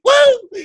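high-pitched short whoop; excited whoop. Real, not acted. Fan planning yearly trips, high excited whoop. Whoops over going every year.

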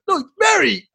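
high, loud "Berry" with an e; friend correcting. Retold or imitated. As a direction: correct him firmly. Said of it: "He was like".

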